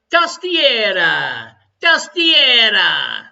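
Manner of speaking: slow, loud Italian flourish; dramatic Italian word. Pretend. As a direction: stretch the word theatrically.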